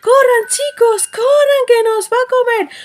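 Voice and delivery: high, loud alarm call to run; panicked yelling. Acted. Little goats warning each other to flee, panicked shouting. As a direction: shout the warning as if the wolf is right there.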